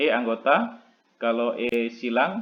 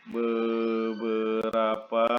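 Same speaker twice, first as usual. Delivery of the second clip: Beberapa drawn out very slowly, syllable by syllable; slow dictation; 3 semitones lower, 4 dB quieter, much slower than usual. Shown as a demonstration. Teacher spelling out a term for students, very slow. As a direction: stretch Beberapa out as if writing it on the board. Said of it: "teacher repeats word for writing".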